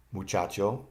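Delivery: Muchacho with CHA punched; stress on CHA. Shown as a demonstration. Teacher showing learners the accent, CHA stressed in Muchacho. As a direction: lean on the middle CHA of Muchacho. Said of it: "the accent is on C H A".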